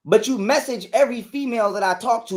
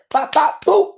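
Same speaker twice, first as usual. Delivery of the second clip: punch sound effects; 7 semitones higher, 4 dB louder, slower than usual. Retold or imitated. Imitating the hits.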